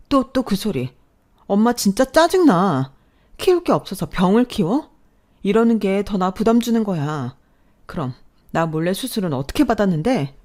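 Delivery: furious, exasperated daughter; exasperated yelling. Retold or imitated. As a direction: snap at her mother in frustration. Daughter still scolding her mother.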